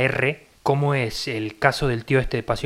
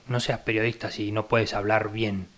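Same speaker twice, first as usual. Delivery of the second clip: ordinary talk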